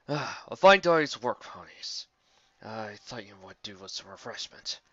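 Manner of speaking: slow, lightly voiced genteel griffin; courtly griffin. Galahad offering drinks to the tired ponies. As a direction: offer it graciously.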